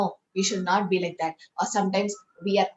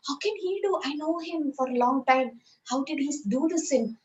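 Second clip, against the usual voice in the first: imitating disbelief; 6 semitones higher than usual. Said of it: "Sometimes we get shocked".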